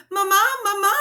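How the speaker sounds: high, loud, breathless call for mama; gasped. A narrator's reading. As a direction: gasp it out as the boy runs up. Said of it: "he gasped".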